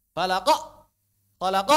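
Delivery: falaq cut off hard with a glottal stop; falaq, loud abrupt catch after q; jerked, added hamzah. Shown as a demonstration. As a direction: show the mistake: jolt the end of falaq. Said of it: "itu nambah hamzah".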